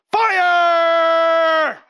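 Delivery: imitating a preacher's sudden, high, loud shout; shouting fire. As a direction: belt out "Fire!" as the preacher praying over him did. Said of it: "he lays his hand on my chest and he goes".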